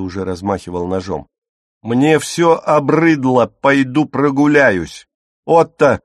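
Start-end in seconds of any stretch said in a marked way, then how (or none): 1.8-5.1 slow, loud and high exasperated declaration; loud weary fury; fed up. A narrator's reading. Otto storms off, fed up. 5.4-6.0 loud high shout of his name; mother shouting to stop her son; sharp call. A narrator's reading. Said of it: "прикрикнула Фрида".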